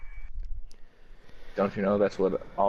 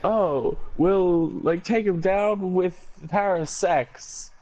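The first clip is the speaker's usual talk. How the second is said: mock movie character; 10 semitones higher, slower than usual. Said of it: "that one guy's saying".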